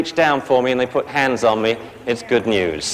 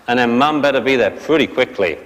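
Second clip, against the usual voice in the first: normal talking voice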